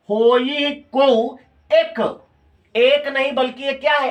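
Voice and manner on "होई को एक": slow syllable-by-syllable reading. Shown as a demonstration. Teacher to students, reading the verse slowly by its beats. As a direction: draw out each syllable very slowly, counting beats. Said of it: "बोल के लगाना है".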